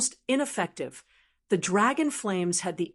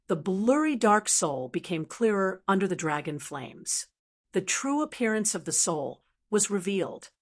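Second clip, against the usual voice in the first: ordinary delivery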